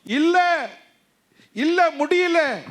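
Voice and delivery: high, loud, slow defiant no; loud refusal. Acted. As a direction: cry out a refusal. Answering the devil's taunt.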